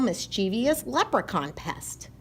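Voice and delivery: usual speaking voice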